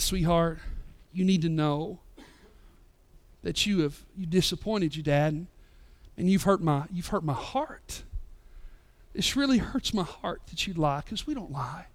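put-on soft, hushed words to a sorry child; gentle fatherly talk. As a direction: quietly tell her she hurt you. Dad gently correcting daughter.

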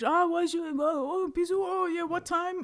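high, excited put-on Frenchman chatting on his phone; mock French guy on the phone. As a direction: ham up a Frenchman arranging a gig by phone, pitched high. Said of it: "like, speaking in French".

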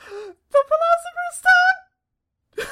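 very high, slow, drawn-out squeal; very high dramatic voice. Acted. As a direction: shriek the title like a trailer climax. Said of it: "Go higher".

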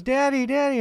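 a daughter calling her dad; high little girl voice. Acted. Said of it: "She goes".

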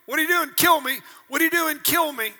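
shouted questions and loud pleas to die; loud back-and-forth. Retold or imitated. Preacher voicing God and Elijah, shouting. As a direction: belt out God's question and Elijah's answer. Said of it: "God asked him two or three times".

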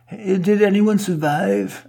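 high, slow worried question; mock Jessica Alba. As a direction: ask it breathlessly like a movie heroine.

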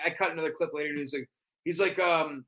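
usual speaking voice

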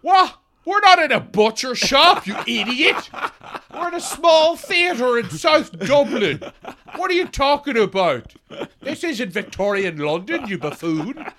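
hammed-up exasperated improviser; mock indignant scolding. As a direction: berate your scene partner in mock disbelief.

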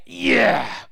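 loud eager yeah; gloating cheer. Retold or imitated. Audience eager for the son's punishment, loud gloating cheer. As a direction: cheer like a crowd out for blood. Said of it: "They're going".

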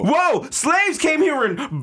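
high, hyped-up commercial voice; hyped energy drink ad voice. Retold or imitated. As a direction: yell it like a cheesy extreme ad. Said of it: "sound like a fucking energy drink commercial".

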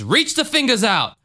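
high, dramatic preacher command; Jim Jones. Acted. Faith healer commands a woman from the pulpit, dramatic preacher voice. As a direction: act out a showy faith healer. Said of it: "he does this, like, dramatic".

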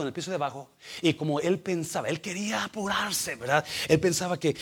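normal talking voice

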